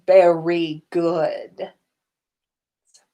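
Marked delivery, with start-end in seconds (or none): none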